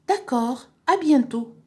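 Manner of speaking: high, lifted goodbye; Mustapha signing off. Acted. Boy ending a phone call, high character voice.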